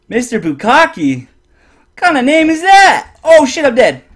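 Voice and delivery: very high, loud, incredulous shriek. Real, not acted. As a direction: yell it out in shocked disbelief. Reacting loudly to chat.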